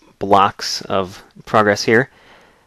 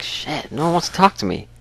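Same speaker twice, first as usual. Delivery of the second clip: raised, sulky mutter as the ignored photographer; grumpy Frank; 7 semitones higher, 4 dB quieter than usual. Retold or imitated. Gamer voicing Frank's sulky grumble. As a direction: grumble that nobody will talk to you. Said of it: "Frank's just like".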